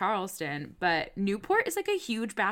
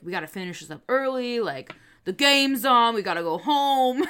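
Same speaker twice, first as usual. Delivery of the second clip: louder and higher, pushing to leave; retold impatient crew; 5 semitones higher, 9 dB louder than usual. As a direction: imitate loud, impatient stagehands, building as it goes. Retelling crew urging to leave.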